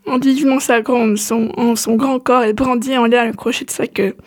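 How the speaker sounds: usual speaking voice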